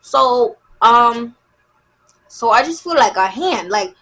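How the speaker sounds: normal talking voice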